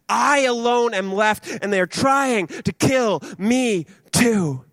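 slow, high aggrieved lament; self-pitying complaint. Acted. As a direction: drag out each word in complaint. Elijah voiced complaining to God.

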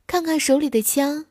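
ordinary delivery, nothing marked

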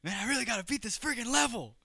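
high, fast, fed-up outburst; frustrated gamer. Retold or imitated. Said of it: "and I'm just like".

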